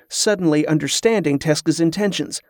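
usual speaking voice

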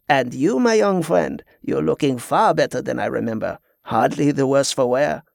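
put-on genial French-accented pirate, varied pitch. Pirate turns to Giacomo, genial French accent. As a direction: greet the young man as a French pirate.

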